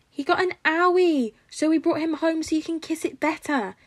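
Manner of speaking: put-on high, loud toddler voice; beaming child. A narrator's reading. Little boy telling his papa to kiss the hero better, high and beaming. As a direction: explain it sweetly like a three-year-old. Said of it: "explains with a beaming smile".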